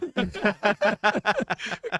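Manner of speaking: loud high laugh; rising burst of laughter. Real, not acted. Laughing over the lucky escape.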